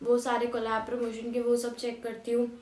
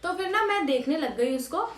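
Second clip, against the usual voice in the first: ordinary talk, nothing marked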